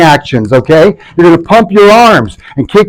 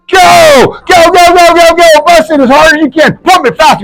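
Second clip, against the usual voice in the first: fitness coach shouting; 12 semitones higher than usual. Real, not acted. Coach shouting at the room during a workout. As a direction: yell them through the last seconds.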